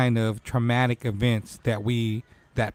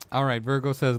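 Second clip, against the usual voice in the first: ordinary talk, nothing marked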